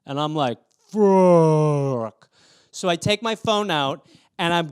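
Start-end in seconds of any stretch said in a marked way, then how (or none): 0.9-2.3 long, loud, stretched-out curse; distorted slow-motion yell. Retold or imitated. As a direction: drag the word out as if time slowed. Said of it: "it's, like, slow motion".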